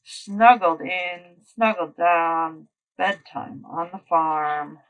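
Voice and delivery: lively storybook reading; reading to children. Acted. Teacher reading a bedtime book to the class. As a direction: read it out brightly for little listeners.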